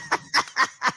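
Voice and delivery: a short unvoiced chuckle; real little laugh before quoting his wife; breathy laugh. Real, not acted. Laughs at wife's remark.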